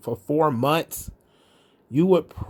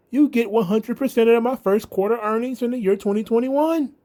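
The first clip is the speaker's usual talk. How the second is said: higher, lightly voiced Hardy, hamming up a too-calm "oh no"; mock his limp, no-surprise reaction, pitched up; lightly mocking Matt Hardy, pitched up and calm; 8 semitones higher than usual. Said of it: "very calmly, it's like".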